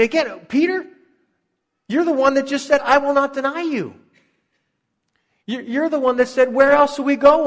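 very high, loud mock disbelief; incredulous challenge. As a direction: throw his own boasts back at him in a high, incredulous voice. Preacher mock-challenges Peter over his boasts.

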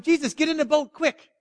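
high, urgent call for help; acted calling out. Disciples calling to Jesus from the boat, urgent cry. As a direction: pitch it up as a hurried plea. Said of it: "they don't cry out to him".

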